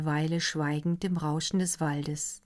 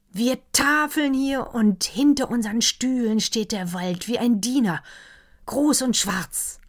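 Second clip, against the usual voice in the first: a lightly voiced doctor, higher and louder, carried away; enthusiastic doctor; 6 semitones higher, 6 dB louder than usual. Doctor raving to the counts over dinner, higher and louder. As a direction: let the doctor gush over the dark forest.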